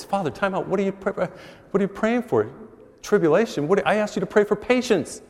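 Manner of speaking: a parishioner scolding the priest mid-prayer, indignant and high; exasperated woman. Retold or imitated. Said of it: "she goes".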